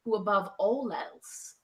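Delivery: slow, low, solemn elven narration; Galadriel as Cate Blanchett. Acted. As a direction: intone it like a grave ancient prophecy, slowly. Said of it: "do this in my Cate Blanchett Galadriel voice".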